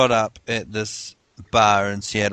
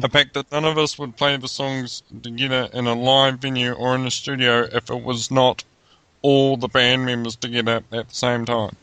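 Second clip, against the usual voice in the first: lightly mock-formal statement voice; a hint of a prim official statement; reading the statement; 2 semitones higher than usual. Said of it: "what all of them signed".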